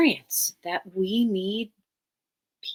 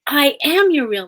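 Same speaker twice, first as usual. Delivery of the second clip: louder and higher, a wounded protest; defensive parent; 7 semitones higher, 9 dB louder than usual. Retold or imitated. Mother protests to her adopted child, loud and hurt. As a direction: snap back defensively.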